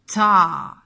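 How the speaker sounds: "ta" loud, vowel held long; syllable sounding-out. Shown as a demonstration. Teacher blending a syllable for children, "ta" loud and stretched. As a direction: hit the t of "ta" and hold the vowel. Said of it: "j'entends dans la syllabe ta".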